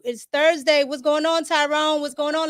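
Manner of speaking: normal talking voice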